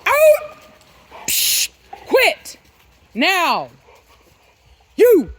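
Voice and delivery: very high loud barked commands; dog command voice. Real, not acted. Owner using her loud command voice on the dogs, very high. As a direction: project each word like a whip crack. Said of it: "the good old voice".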